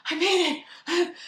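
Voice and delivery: gasping, high and slow; mock breathless arrival. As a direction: act winded from rushing in late. Acts out arriving late, gasping.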